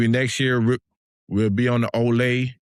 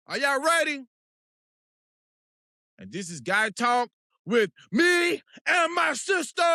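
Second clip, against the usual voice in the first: hype announcer; 12 semitones higher, 3 dB quieter than usual. Acted. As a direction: announce the show like a radio host. Show intro announcer voice.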